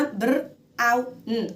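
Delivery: brown split as "بر", "أو", "ن"; sound by sound. Shown as a demonstration. Teacher explains memorising words in syllables, sound by sound. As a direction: break brown into three separate sounds. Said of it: "فأنا مقسمة مقاطع".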